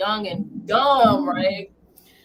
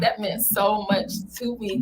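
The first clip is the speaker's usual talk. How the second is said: usual speaking voice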